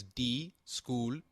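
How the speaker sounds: "discúlpeme" drawn out, syllable by syllable; slow careful discúlpeme. Shown as a demonstration. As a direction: spell out "discúlpeme" slowly for a learner. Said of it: "is pronounced as".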